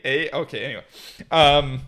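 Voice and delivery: ordinary talk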